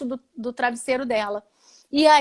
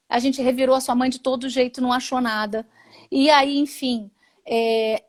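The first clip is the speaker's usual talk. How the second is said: usual speaking voice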